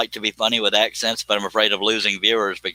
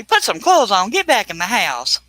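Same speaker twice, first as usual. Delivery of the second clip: scolding neighbors; 10 semitones higher than usual. Retold or imitated. People telling the streamer off, mock scolding voice. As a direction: nag him like a fed-up neighbour. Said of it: "People just say".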